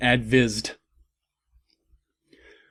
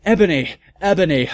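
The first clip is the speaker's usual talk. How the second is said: higher, louder falling cries of her name; mock sad shout; 6 semitones higher, 5 dB louder, faster than usual. Draco calling after fleeing Ebony, higher mock sad shout. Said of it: "shouted Draco sadly".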